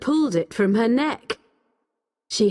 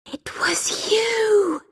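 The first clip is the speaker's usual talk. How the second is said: slow, high, airy whisper; breathed realisation; 13 semitones higher, slower than usual. A narrator's reading. Becky to the old man on recognising him, breathed. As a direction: breathe it out slowly in stunned accusation. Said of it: "she breathed".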